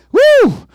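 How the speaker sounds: high loud woo; sharp ecstatic preacher cry; high whoop. Real, not acted. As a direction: let out a joyful whoop. Preacher's shout of fervor.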